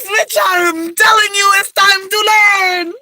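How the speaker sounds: high, slow, laughing yell; laughing shout. Real, not acted. Telling viewers it's time to learn Spanish, laughing and yelling high and slow. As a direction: push it out through laughter.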